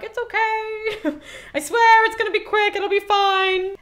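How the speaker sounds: high, squeaky mock comfort; mock reassuring chorus. Chorus soothing doomed Cassandra, high mock reassurance. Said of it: "they're kind of like".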